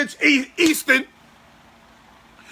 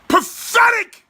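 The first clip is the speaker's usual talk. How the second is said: disgusted outburst; 10 semitones higher, slower than usual. Real, not acted. Repeating his verdict with disgust.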